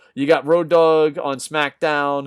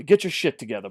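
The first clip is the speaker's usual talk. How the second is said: ordinary talk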